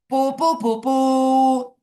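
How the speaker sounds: high, loud tooting on "poo"; imitated nobat fanfare. Shown as a demonstration. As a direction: toot it out like a royal fanfare.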